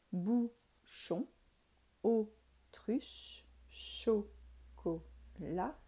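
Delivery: each word slowly split into separate syllables with clear gaps; measured classroom syllable count. Shown as a demonstration. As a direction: break every word into its syllables, pausing after each. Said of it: "le nombre de syllabes qui correspond".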